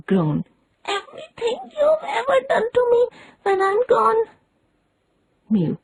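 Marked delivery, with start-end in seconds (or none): 0.8-4.2 high crying wail; wailing dying boy. A narrator's reading. Tom to Sid, high hammed-up crying wail. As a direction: sob it out like a dying martyr. Tom's deathbed act.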